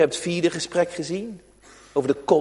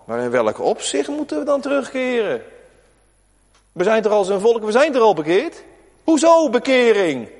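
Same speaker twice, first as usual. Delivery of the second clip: higher, protesting questions; indignant people; 6 semitones higher, 4 dB louder than usual. Acted. The people protest to God, indignant and higher. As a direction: push back, offended. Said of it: "En dan zegt het volk".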